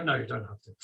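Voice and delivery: normal talking voice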